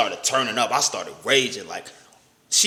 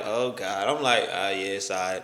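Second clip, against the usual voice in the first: lower, softer monotone; lower, softer, held-back monotone reply to his girlfriend; 7 semitones lower, 5 dB quieter than usual. Retold or imitated. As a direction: hold every feeling back, keep it level. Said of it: "I'm real monotone 'cause when I get upset".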